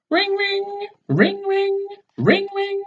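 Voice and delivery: high sung-out ring ring like a phone. Acted. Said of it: "The video phone's ringing".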